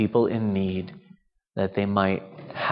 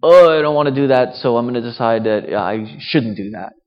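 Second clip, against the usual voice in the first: grumpy mock grumble, much louder and quick; grinchy; 7 dB louder than usual. Preacher mocks a grinchy churchgoer, grumbling loudly. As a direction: play the reluctant Christmas grinch, half-mocking. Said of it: "um, grinchy".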